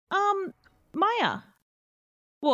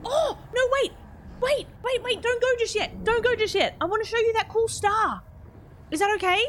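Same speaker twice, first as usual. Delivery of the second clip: eager calling out; 8 semitones higher than usual. Real, not acted. Calling back departing visitor.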